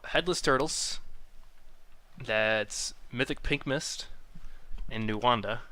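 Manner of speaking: slow, drawn-out lineup announcing; announcer read. Acted. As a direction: present each team name slowly.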